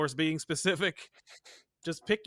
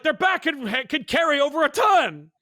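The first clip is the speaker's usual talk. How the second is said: higher, louder indignant outburst; indignant protest; 9 semitones higher, 7 dB louder than usual. Retold or imitated. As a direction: protest loudly that the back can carry a ton. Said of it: "I'm like".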